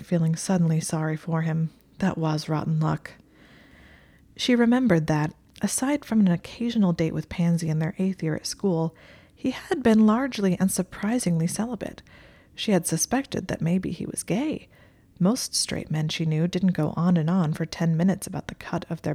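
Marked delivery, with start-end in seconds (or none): none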